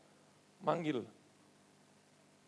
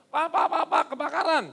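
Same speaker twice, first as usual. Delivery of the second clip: loud, high urgent calls; mock alarmed shout; urgent calling; 9 semitones higher, 9 dB louder than usual. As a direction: call out in alarm about a fire. Someone calling about a fire.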